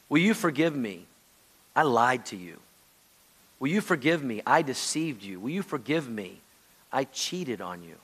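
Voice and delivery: low, hushed confession; acted repentance. Confessing lies and cheating, asking forgiveness, low hushed repentance.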